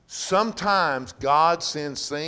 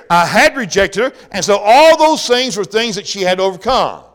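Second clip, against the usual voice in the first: louder, higher, driving sermon delivery; full-force preaching; 6 semitones higher, 7 dB louder than usual. Acted. Pastor to his flock about the Canaanite woman, fervent preaching. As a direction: preach it hard and build the point.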